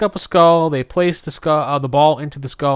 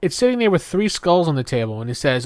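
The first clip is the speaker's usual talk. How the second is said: ordinary delivery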